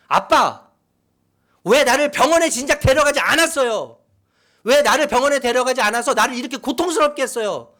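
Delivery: put-on loud, high demonstration of a child's anger; angry protest. Shown as a demonstration. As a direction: confront your father at full voice. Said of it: "화를 내야 된다".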